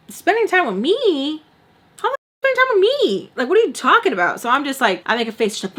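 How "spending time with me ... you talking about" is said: high, loud incredulous retort; retold quarrel, fast and shrieky; indignant disbelief. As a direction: act out outraged disbelief, pitch leaping up.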